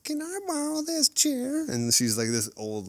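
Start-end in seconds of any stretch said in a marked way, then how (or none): 0.0-1.7 put-on high, slow little old lady asking politely; high, slow old lady's voice borrowing a chair. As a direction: mimic a sweet old lady, much higher and slower.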